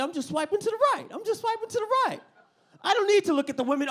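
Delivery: high, fast, squeaky self-defense; high defensive excuse. Pretend. Mock excuse voice.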